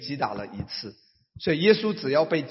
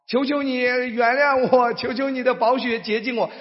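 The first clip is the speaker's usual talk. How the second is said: mock begging; 8 semitones higher, 4 dB louder than usual. Believer begging God for forgiveness, mock pleading.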